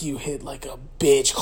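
low, soft put-on menace; mock defiant taunt. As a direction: taunt quietly, pitched well down. Acts defiant taunt after slap.

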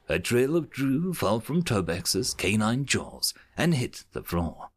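ordinary talk, nothing marked

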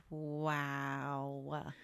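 deadpan, underwhelmed; unimpressed wow. Retold or imitated. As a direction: let it out in mock amazement. Unimpressed reaction to mood ring.

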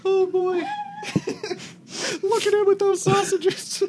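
high, wheezing laughs through words; laughing. Real, not acted. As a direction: laugh until you can barely speak. GM laughing at sausage eating.

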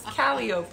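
"calliope" as KAL-ee-ope; wrong pronunciation. Real, not acted. Host unsure of the word, "calliope" mispronounced KAL-ee-ope. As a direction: end "calliope" like rope, no final ee. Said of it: "I'm not saying it right".

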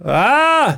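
high-pitched put-on cry; escalating mock scream. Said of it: "Så gör det ännu mer".

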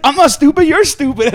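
high, loud imitated child; squabbling kid. Kid answering another kid's insult, mock indignant. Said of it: "The kid's like".

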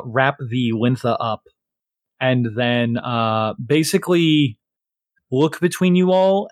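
usual speaking voice

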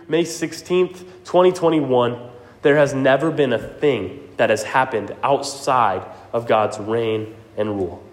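ordinary talk

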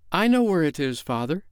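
put-on high, eager girl's call; eager cry. A narrator's reading. Wendy glad to be of service, crying out eagerly. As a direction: pipe up brightly, keen to help. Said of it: "Wendy cried".

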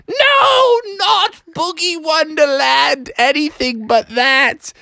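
high, wailing mock protest; hammed-up whiny kid's refusal; horrified pleading. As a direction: plead like a kid dreading it. Froggy protests the trip in horror.

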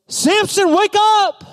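very high, stretched wake-up cry; calling out alarm. Acted. Delilah calls out to sleeping Samson.

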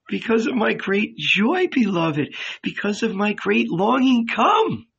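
high, tearful voice of a woman in love; crying for joy. Acted. Magdalene answers Jesus in tears of joy, high and tearful. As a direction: weep it out gladly, reaching up on come. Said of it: "why are you crying".

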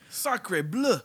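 hammed-up French exclamation; high mock French outburst; french chef.

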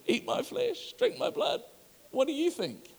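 high, soft, teasing "eat my flesh"; mock high Jesus. Jesus teasing the offended crowd, high and soft. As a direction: tease them in a high voice.

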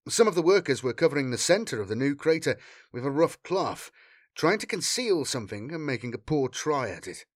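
ordinary delivery

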